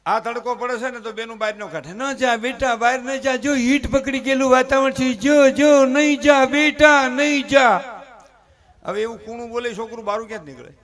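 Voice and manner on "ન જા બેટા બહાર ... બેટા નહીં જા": pleading mother, louder and pleading; mother fussing over her child, urging him to stay in out of the heat. Retold or imitated. Mother begging child indoors.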